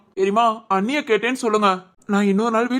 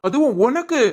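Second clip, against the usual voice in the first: higher, incredulous angry reproach; indignant scolding; 4 semitones higher than usual. Acted. Father to daughter who brought mother home, indignant. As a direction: scold her in disbelief.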